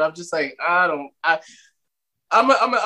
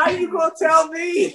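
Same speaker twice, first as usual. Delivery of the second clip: high-pitched mock indignation; indignant retort; 12 semitones higher than usual. Answering strangers who judge the accent, indignant. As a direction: throw it back, pitched way up.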